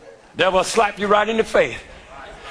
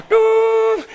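high loud imitated hospital machine wail; mock machine wail; 12 semitones higher, 3 dB louder than usual. Preacher imitating a hospital machine to his church, high shouted wail. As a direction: wail it out like a beeping monitor. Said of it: "the machine going".

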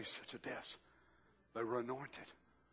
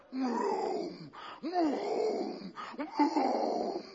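imitated lion roar; 9 semitones higher, much slower than usual. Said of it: "we're awakened by this sound".

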